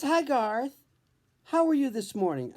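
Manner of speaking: a hint of a chirpy early-morning doctor; Doctor Jennifer's voice. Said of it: "Doctor Jennifer".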